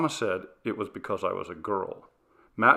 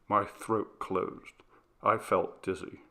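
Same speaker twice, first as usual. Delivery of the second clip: usual speaking voice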